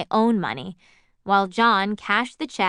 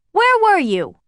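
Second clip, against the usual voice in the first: high, loud, suspicious demand; mother snaps at the late daughter; sharp demand; 12 semitones higher, 8 dB louder, slower than usual. Acted.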